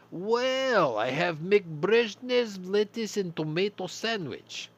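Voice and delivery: put-on loud, high, slow hammy Russian accent; bad Russian accent. Soviet cashier pitches the Putin burger in a bad Russian accent. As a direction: oversell the specials in fake Russian. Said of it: "a really bad Russian accent".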